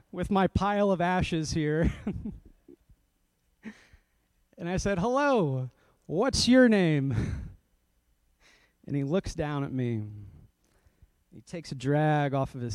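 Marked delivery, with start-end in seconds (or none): 5.0-7.2 higher, louder, drawn-out pastor greeting; hammed-up friendly hello from a pastor. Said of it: "in my best friendly pastor voice".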